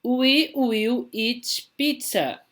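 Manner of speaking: slow, high model reading; model sentence. Shown as a demonstration. Teacher reads the last slide example, slow and high. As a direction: read it high and slow, clearly for the class. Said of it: "Olha a última aqui".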